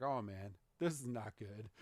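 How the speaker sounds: low, soft worried aside; nervous worry. Acted. Go nervous facing the hunters.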